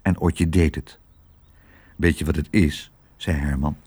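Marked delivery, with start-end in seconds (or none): 2.0-2.9 low, confiding dog voice; low-pitched, subdued explanation; sheepish dog. Acted. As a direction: begin a confession, pitched down. Ashamed dog starts confiding.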